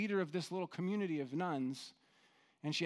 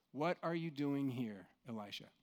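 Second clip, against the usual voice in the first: gentle question; 4 semitones lower, 6 dB quieter than usual. Shown as a demonstration. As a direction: ask it tenderly, not in anger. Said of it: "that tone is correct".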